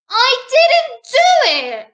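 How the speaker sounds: put-on high, loud protesting child; storybook girl's indignant denial; little princess. A narrator's reading. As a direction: insist you are innocent, voice way up. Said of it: "said the little princess".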